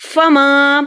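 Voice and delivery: फमा with a long-held मा; lengthened मा. Shown as a demonstration. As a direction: stretch the आ at the end of फमा. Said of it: "मा को इसलिए लंबा किया".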